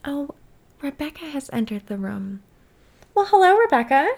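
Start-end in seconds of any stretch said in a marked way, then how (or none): 3.1-4.1 host greeting. Pretend. Greeting invented guest.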